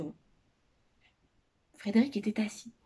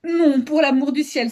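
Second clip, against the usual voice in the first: loud, higher-pitched plea; emphatic, raised-voice horror; alarmed exclamation; 5 semitones higher, 6 dB louder than usual. A narrator's reading. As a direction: cry out in protest, hitting the first word. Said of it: "s'écria monsieur Alexandre".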